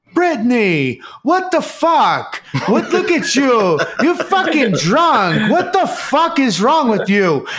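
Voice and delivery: high, slow, hammed-up yelling at his drunk fiancée; mock angry yell. Boyfriend scolding drunk girlfriend at airport, mock yelling. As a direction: blow up, half laughing, drawn out.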